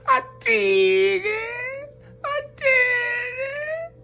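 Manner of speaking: high, very slow, wailing mock sob; mock Tin Man crying. Mocking fans who cried over Spence's wreck, in a wailing fake sob. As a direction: ham up a blubbering Tin Man, stretching each word. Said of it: "y'all was crying just like the Tin Man".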